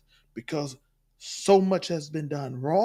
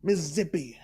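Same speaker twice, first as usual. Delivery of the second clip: ordinary talk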